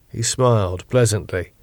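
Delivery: plain reading voice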